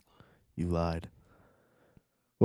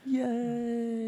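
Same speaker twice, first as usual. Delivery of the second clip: squeaky cheer. Acted. Mock cheer.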